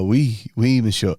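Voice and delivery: mock French accent. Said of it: "'cause he's French".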